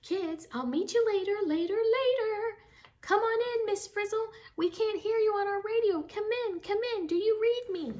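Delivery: high, loud, desperate radio call; calling over radio. Acted. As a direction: shout into the radio for the lost teacher.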